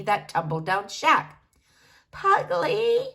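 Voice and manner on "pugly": high, cracking voice of an ancient granny; quavering but clear old granny; very old woman calling her grandson. Acted. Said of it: "her voice cracking but clear".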